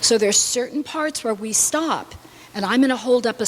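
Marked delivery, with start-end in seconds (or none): none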